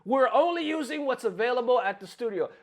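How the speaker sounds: high-pitched mimicking of a lame excuse; mocking quote. Victim repeating the scammer's excuse, mocking mimicry. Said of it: "Talk about".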